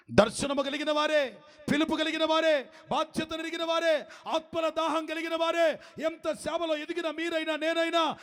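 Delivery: high, shouted sermon build; fiery preaching. Acted. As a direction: thunder it out to the congregation. Preaching at full force.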